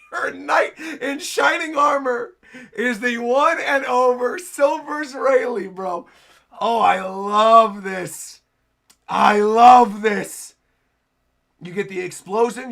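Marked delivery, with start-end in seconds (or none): 0.1-5.7 high, loud, slow excited proclamation; elated fan shouting it out; thrilled gushing. Real, not acted. Fan gushing over the rescue. 6.6-10.5 loud, long-drawn relishing cry; overjoyed, stretched-out exclamation; real delight. Real, not acted. Delighted reaction to the scene.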